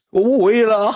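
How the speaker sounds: high, whiny imitation of weeping; mock crying. Friend crying in the taxi refusing to return, mock tearful whine. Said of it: "哭了，打车".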